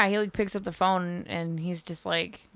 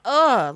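loud, high groan of unease; retold shudder of discomfort; uncomfortable ugh; 6 semitones higher, 10 dB louder than usual. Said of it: "that made me super uncomfortable".

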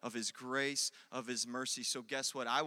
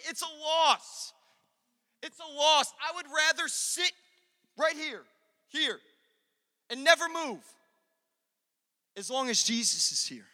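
high, loud impassioned sermon; loud, emphatic pulpit delivery; full-force preaching; 11 semitones higher, 12 dB louder than usual. Real, not acted. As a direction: preach it out with all your force.

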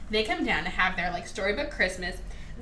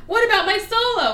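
whiny Fife; 10 semitones higher, 6 dB louder than usual. Retold or imitated. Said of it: "And he goes".

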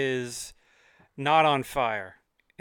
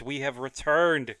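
ordinary talk, nothing marked

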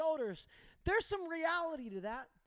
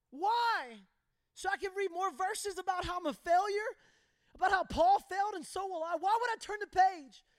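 higher, louder indignant questions; angry retort; 6 semitones higher, 5 dB louder than usual. Retold or imitated. Son arguing with his father, a higher, louder indignant retort. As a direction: push back hard at dad.

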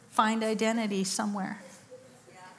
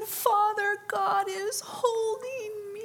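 high, slow, tearful voice; quoting a man weeping; crying; 12 semitones higher, much slower than usual. Retold or imitated. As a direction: let the words break with tears. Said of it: "he's starting to cry".

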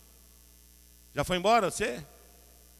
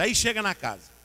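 ordinary talk

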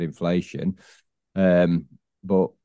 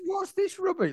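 sudden much higher mock-indignant squeal; mock outrage; 5 dB quieter than usual. Teasing his cohost about another podcast, mock outrage. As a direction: pretend to be scandalised by the betrayal.

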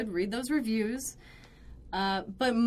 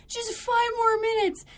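high, begging plea for more time; pleading customer; 12 semitones higher, 3 dB louder than usual. Retold or imitated. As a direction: beg for five more minutes. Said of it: "it's my favorite phrase".

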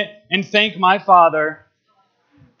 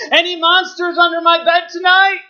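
Calvin yells; 8 semitones higher than usual. Retold or imitated. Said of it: "Calvin yells underneath".

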